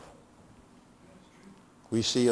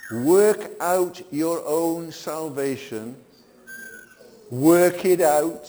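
slow, high and loud, every word weighted; solemn preaching emphasis; 6 semitones higher, 6 dB louder, much slower than usual. Acted. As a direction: lay out each word like a command. Preacher quotes Paul emphatically.

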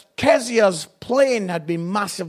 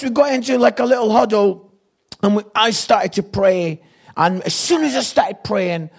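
ordinary talk

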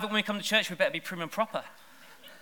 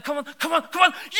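climbing, higher and louder yelling; football fan cheering; 10 semitones higher, 5 dB louder, faster than usual. Retold or imitated. Preacher imitating a fan at a big game, shouting. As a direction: cheer as your team nears the goal. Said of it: "and they're".

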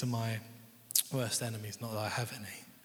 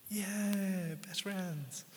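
higher-pitched, lightly put-on cheer; mock delight; 7 semitones higher, much slower than usual. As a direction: play a pretend happy reunion, voice lifted. Acted delight at friend's return.

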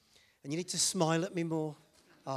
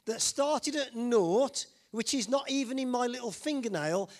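ordinary talk, nothing marked

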